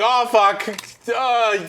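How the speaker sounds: mocking a friend caught mid-conversation; flustered player. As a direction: blurt it like you've been caught out. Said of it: "you were like".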